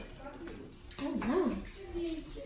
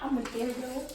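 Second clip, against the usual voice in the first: usual speaking voice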